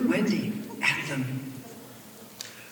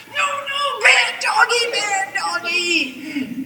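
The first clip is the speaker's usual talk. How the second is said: very high squealing shout, drawn out; terrified scream; 14 semitones higher, 5 dB louder, slower than usual. A narrator's reading. Villain screaming at wolves tearing his jeans, terrified. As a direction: squeal in panic, stretching the last words.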